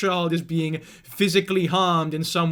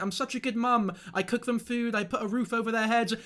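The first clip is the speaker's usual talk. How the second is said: softer, higher, a hint of a self-praising mum; lightly voiced preening parent; smug influencer mum; 3 semitones higher, 6 dB quieter than usual. Streamer mocking influencers who brag about feeding kids. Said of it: "This is something that happens with loads of influencers".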